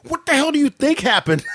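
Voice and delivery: high, irritated snap back at dad; stung son snapping at dad, high; exasperated retort. Retold or imitated. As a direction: bite back in pain. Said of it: "reply to dad".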